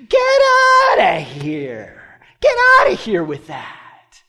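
high, drawn-out shout that drops at the end; dismissive yell. Retold or imitated. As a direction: lead the crowd in a mock Roman yell. Acts out Rome, leading the audience.

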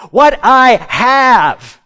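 slow and emphatic, every word weighted; preacher slowly spells out What I have for the room to echo; slow call to repeat. Shown as a demonstration. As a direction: lead the congregation line by line, slowly. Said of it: "Say this with me".

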